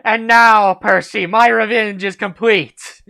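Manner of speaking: loud, high hammed-up villain gloat; mock villain. Villain gloats to Percy over his revenge, hammed-up triumph. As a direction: ham up a triumphant villain's big reveal.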